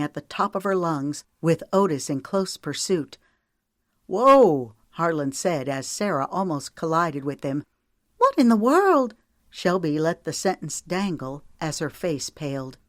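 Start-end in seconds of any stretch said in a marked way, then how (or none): 4.1-4.7 loud startled whoa; surprised. A narrator's reading. Near collision. 8.2-9.1 high, loud alarm; shocked. A narrator's reading. Shelby shocked.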